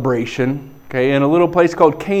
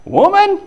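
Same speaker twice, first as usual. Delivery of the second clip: "Woman?" pitched way up, exaggerated; jesting emphasis. Preacher jesting as Jesus answering his mom, exaggerated emphasis on "Woman?". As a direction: hit "Woman?" high as a mock-indignant question. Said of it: "emphasizing that word just for fun".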